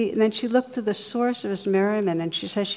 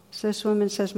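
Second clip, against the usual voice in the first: ordinary delivery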